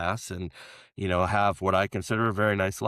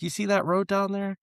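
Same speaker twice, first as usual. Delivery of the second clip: higher, knowing hint at the road; sly politician; 12 semitones higher than usual. Retold or imitated. Mexican host hinting to the American, higher and sly. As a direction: point out the road with a wink. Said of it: "He's like".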